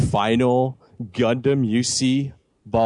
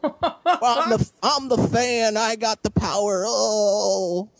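whiny manchild voice; 10 semitones higher, slower than usual. Pretend. Mocking entitled fan calling mom.

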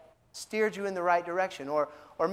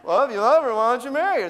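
loud, higher comic kid impression; Pee Wee Herman; 4 semitones higher, 5 dB louder, much faster than usual. Preacher replaying God's answer for laughs, childlike comic voice. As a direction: put on an exaggerated childlike voice. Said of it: "speak in that kind of a tone".

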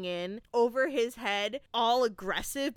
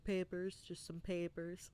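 much softer, lower, offhand; evasive mumble; 4 semitones lower, 11 dB quieter than usual. Retold or imitated. The dude dodging the cops' suitcase question, quiet evasive answer.

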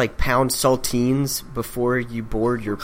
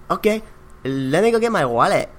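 higher-pitched imitated Mexican accent; 6 semitones higher than usual. Coworker agreeing to lunch, imitated Mexican accent. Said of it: "and he was Mexican".

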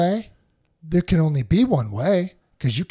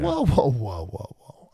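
a startled whoa that dies away; fading whoa. Retold or imitated. [name] reacting to the broker's warning, fading whoa. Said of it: "And I go".

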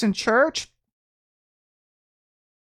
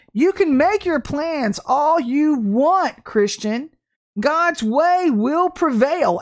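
raised, slow, emphatic preaching; 5 semitones higher than usual. Acted. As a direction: warn the congregation, slow and strong. Preaching warning.

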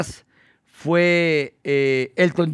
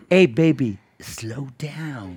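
calming Britney; 3 semitones lower, 3 dB quieter, much slower than usual. Retold or imitated. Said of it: "le dije".

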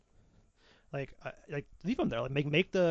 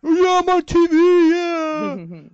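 much higher and louder, giddy; excited fan; 15 dB louder, much slower than usual. Retold or imitated. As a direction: squeal it like a starstruck fan.

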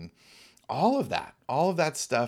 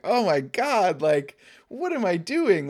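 exasperated self-talk; 7 semitones higher, 4 dB louder than usual. Retold or imitated. Retelling her own anger and failure.

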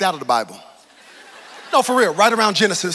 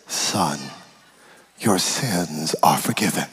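Jesus, gentle and low; slower than usual. Retold or imitated. Jesus tells the paralysed man calmly and slowly. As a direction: deliver the pardon slowly and low. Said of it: "first thing he says to me is".

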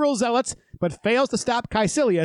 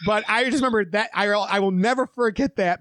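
ordinary delivery

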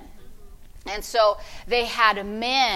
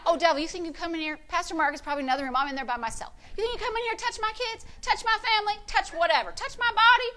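higher, rising defiance at the devil; mock angry rebuke; 5 semitones higher, 4 dB quieter, faster than usual. Preacher scolding the devil in her room, angry. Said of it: "I get mad".

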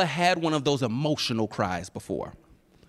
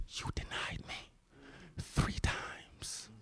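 very slow whisper, barely audible; drawn-out, voiceless hush; hushed reproach; 20 dB quieter, much slower than usual. Acted. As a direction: whisper it as a wounded accusation. Acts out Jesus's silent look.

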